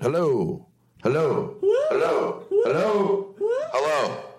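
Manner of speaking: audition one phone greeting after another; phone voice tryouts. Shown as a demonstration. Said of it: "Find my phone voice".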